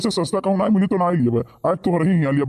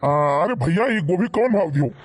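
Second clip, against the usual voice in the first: customer voice; 5 semitones lower than usual. Acted. Customer asks price.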